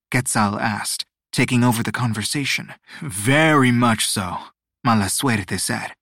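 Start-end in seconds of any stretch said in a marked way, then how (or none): 3.0-4.5 slick god voice. Acted. Mala Suerte's reply.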